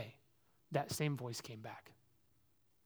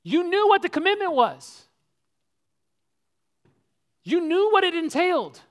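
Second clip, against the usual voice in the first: much higher and louder, scolding; accusing rebuke; 12 semitones higher, 14 dB louder than usual. Retold or imitated. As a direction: throw his own commitment back at him. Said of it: "You'd say to me".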